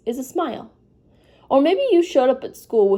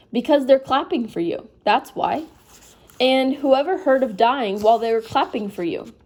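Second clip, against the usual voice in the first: ordinary delivery, nothing marked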